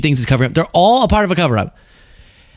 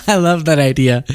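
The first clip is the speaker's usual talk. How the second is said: ordinary talk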